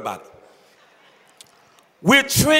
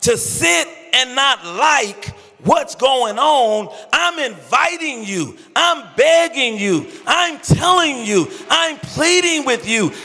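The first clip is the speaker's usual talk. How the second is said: loud, higher, fervent sermon climax, half-sung; full-force preaching; 5 semitones higher, 4 dB louder than usual. Acted. Pastor begging millennials to help reach their friends, loud fervent preaching. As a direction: whoop it up like a preacher at the peak. Pastor's passionate altar appeal.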